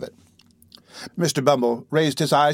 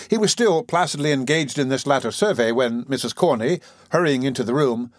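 usual speaking voice

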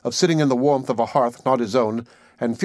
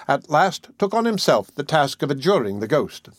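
ordinary delivery, nothing marked

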